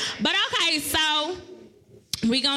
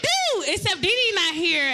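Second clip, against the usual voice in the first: normal talking voice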